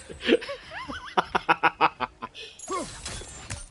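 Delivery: helpless high-pitched laughter; squeaky laugh. Real, not acted. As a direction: wheeze upward into giggles. Cracks up at the sound.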